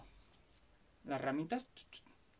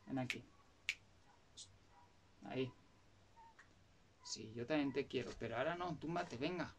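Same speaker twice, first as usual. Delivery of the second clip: soft talk to pet; 7 dB quieter than usual. Real, not acted. Talking softly to the dog.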